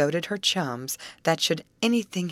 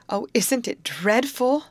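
slow, high-pitched dismay; dismayed lament; 8 semitones higher, 4 dB louder than usual. A narrator's reading. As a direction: draw out the lament, voice lifted. Grace laments brother's disappearance.

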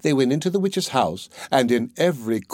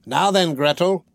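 lightly raised voice of an impatient witch; mild storybook cry, higher in pitch; witch's cry; 6 semitones higher than usual. A narrator's reading. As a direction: call the girl sharply, pitched higher. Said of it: "she cried to the girl".

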